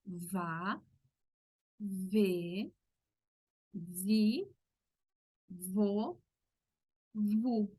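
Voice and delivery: va, ve, vi, vo, vu with a buzzing labiodental v; v syllable drill. Shown as a demonstration. Teacher drilling Portuguese v syllables. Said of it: "nosotros hacemos".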